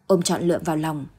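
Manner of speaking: usual speaking voice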